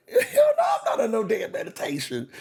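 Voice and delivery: laughing outburst, high yelp into a laughing line; laughing mock denial; laughing hell no. Real, not acted. Mocking the medication excuse.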